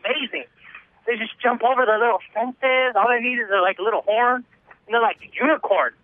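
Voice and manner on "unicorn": ordinary delivery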